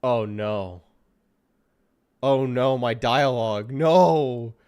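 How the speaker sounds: loud groaning "Oh, no" at his own dialogue; loud dismay. Real, not acted. Gamer reacting to his character's rude dialogue, loud dismay. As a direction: cry out in mock horror.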